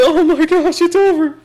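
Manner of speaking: very high mock-agony squeal; hammed-up high wail of doom; retold wail. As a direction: cry it out in falsetto, half laughing. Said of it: "and I screamed".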